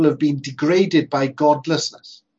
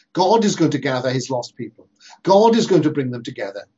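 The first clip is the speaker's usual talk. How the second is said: ordinary talk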